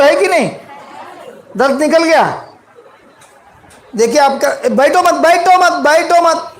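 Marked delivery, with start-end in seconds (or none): 4.7-6.6 high, rapid-fire repeated order; urgent calling out. Real, not acted. Preacher stops congregation sitting, urgent call. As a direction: call out urgently across the room.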